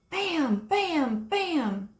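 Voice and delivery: three loud high bangs; banging sound effect; loud knocking. A narrator's reading. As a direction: hit each "Bam" like a fist on glass. Knocking on the windshield.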